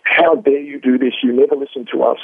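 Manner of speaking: imitating aggression. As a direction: act out an indignant heckler, mildly. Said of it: "being quite aggressive".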